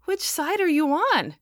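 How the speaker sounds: genuinely lost; bewildered. A narrator's reading. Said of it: "in genuine bewilderment".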